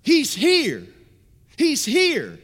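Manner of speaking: loud, high, urgent realization; a blind beggar sensing Jesus near; excited. Retold or imitated. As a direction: shout it as the hope dawns. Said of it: "just echoing in Bartimaeus' heart".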